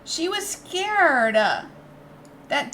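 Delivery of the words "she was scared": pitch. Acted. Pleading for the creature.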